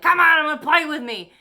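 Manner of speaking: high, loud whiny brat voice; mock bratty child pleading; bratty kid. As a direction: play the inner child tugging at you to play. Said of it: "like he's a brat".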